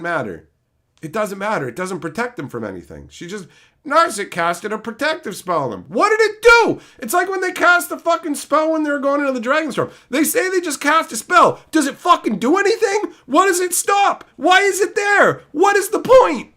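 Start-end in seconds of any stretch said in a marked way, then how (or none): 5.9-16.5 high, loud angry questions; exasperated rant. Real, not acted. Streamer rants at chat about a pointless story spell, high and loud. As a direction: demand an answer, fed up.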